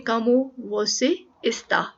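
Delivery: "Como você está?" said very slowly, word by word; slow pronunciation demo. Shown as a demonstration. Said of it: "इसको Portuguese में कहते हैं".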